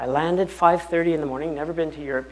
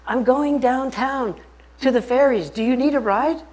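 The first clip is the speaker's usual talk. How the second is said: put-on girl in the cab; 9 semitones higher, 5 dB louder than usual. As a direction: play the girl offering a lift, pitched high. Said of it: "She goes".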